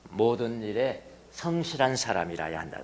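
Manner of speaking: slow, soft scripture reading; unhurried quiet reading of 성실한. Shown as a demonstration. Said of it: "마지막에 뭐라 그러네".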